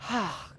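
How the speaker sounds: soft, breathed-out sigh of pleasure; storyteller's contented sigh; deep sigh. A narrator's reading. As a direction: let out a long satisfied sigh. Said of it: "he sighed deeply".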